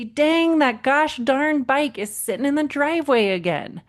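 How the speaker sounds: mock fed-up mom spotting the bike; imitating frustration. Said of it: "And I'd be pissed".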